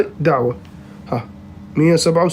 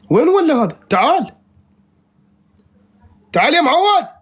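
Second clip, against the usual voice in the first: high-pitched calling out; appel insistant; 7 semitones higher than usual. Real, not acted. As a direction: call the runaway opponent back, voice lifted. Calling back the vanished opponent.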